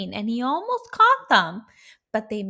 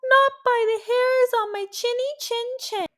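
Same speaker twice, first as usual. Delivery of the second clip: high squeaky little pig; 7 dB louder than usual. A narrator's reading. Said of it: "pigs answer (scene)".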